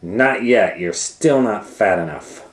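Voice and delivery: lightly voiced cross witch; annoyed witch. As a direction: complain he's still thin. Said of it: "said the witch".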